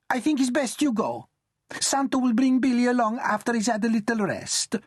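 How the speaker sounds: high nonna voice, firm dismissal; high old lady, firm; firm grandmother. Acted. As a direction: show her the door politely.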